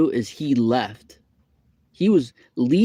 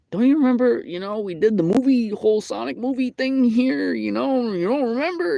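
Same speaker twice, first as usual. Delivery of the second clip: much higher and louder, pleading; high incredulous plea; 12 semitones higher, 5 dB louder than usual. Retold or imitated. Reminding the employee of the Sonic movie, high plea.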